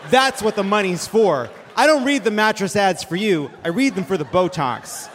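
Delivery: usual speaking voice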